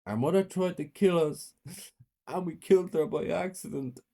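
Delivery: high-pitched mock-tearful plea; feigned distress for the police; pretend crying. A narrator's reading. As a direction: put on a high, upset child's voice. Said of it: "I cried".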